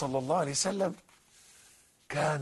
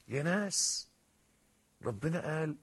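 normal talking voice

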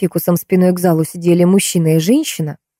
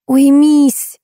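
high, forceful one-word command; exasperated command; 9 semitones higher, 4 dB louder than usual. A narrator's reading. Woman stopping man's teasing, exasperated. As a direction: cut him off, high and cross. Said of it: "в сердцах бросила Варя".

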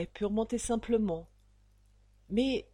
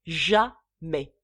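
jamais, thrown out much louder with a hard fall; loud final refusal; 9 dB louder than usual. Acted. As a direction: bark the last jamais, with finality. Father refuses the marriage.

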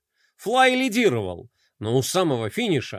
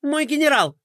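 high, quick anxious plea; high pleading adjutant; 9 semitones higher than usual. Acted. Adjutant pleading with his furious general, high anxious voice. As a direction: plead with the boss nervously.

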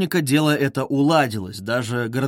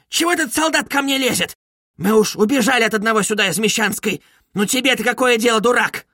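high, indignant scolding; angry outburst; 7 semitones higher than usual. Acted. Widow telling off a policeman, indignant. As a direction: snap at him, voice pitched up.

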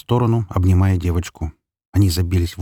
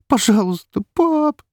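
put-on very high little-girl plea; pleading child; slower than usual. A narrator's reading. Girl begging her father, high tearful plea. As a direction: beg him like a hurt child.

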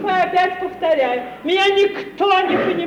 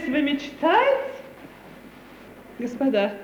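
ordinary talk, nothing marked

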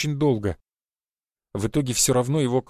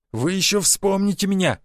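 threatening; 6 semitones higher than usual. A narrator's reading. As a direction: shout a threat. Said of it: "погрозил тот кулаком".

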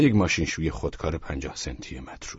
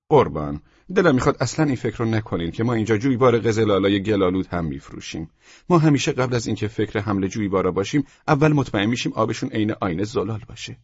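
higher, louder, emphatic seller; raised-voice salesman assuring a buyer; salesman character; 5 semitones higher, 5 dB louder than usual. Acted. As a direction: insist to the customer like a proud shopkeeper. Seller reassures customer.

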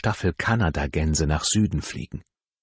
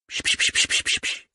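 quick voiceless shushing clicks; bird-calling psch. Acted. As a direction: hiss gently to draw the birds out. Calling birds with psch sounds.

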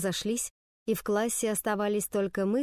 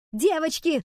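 loud, high call to order; teacher's shout; 7 semitones higher, 5 dB louder, slower than usual. A narrator's reading. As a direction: call out sharply to stop a fight. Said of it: "чтобы мисс Финч так кричала". (громкий окрик учительницы)

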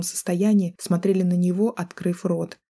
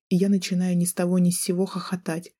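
ordinary delivery, nothing marked

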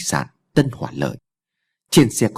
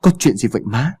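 panicked question; 3 semitones higher than usual. A narrator's reading. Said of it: "Lợi hốt hoảng".

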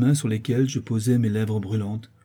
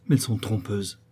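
usual speaking voice

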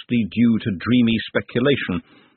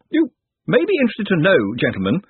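higher pompous academic voice; pompous old wizard; 6 semitones higher, 3 dB louder than usual. Acted. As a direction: lecture it like a fussy old don. Narrator voices Master of Traditions.

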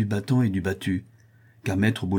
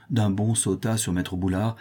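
plain reading voice

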